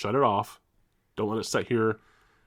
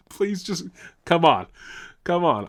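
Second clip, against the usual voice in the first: pleading; 6 semitones higher than usual. Retold or imitated. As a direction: beg the car to hold on. Pleading as car overheats.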